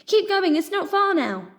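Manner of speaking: high, loud encouraging shout; urgent shout. A narrator's reading. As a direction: urge them on, loud. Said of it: "she shouted".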